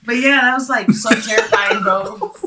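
loud, laughing exclamation; amused, laughter breaking into the words; laughing while talking. Real, not acted. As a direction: say it through real laughter. Laughing with friends over the story.

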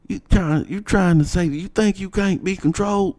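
high mock principal voice, prodding; imitating the principal. Principal baiting a student about control, high mock voice. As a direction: put on a high, needling authority voice. Principal quoted pressing the boy.

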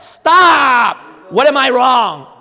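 high, loud cut-off of your own thoughts; shouted self-command. Acted. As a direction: yell at yourself to stop and think. Shouts down his own protest.

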